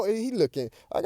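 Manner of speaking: ordinary delivery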